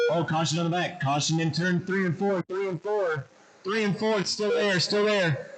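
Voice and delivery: rising, urgent warning call; urgent caution call. Real, not acted. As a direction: call the caution fast and high. Calling a caution on track.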